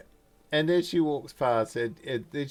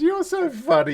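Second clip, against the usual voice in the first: high, loud, delighted squeal; gushing Alicia; 8 dB louder than usual. Acted. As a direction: squeal with delight at an old acquaintance. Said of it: "She goes".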